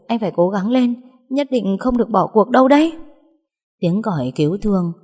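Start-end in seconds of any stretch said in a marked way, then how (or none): 0.1-2.9 raised, louder voice urging him on; urgent pleading. A narrator's reading. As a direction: implore him to hold on. Urging injured man to stay awake. (khẩn khoản động viên)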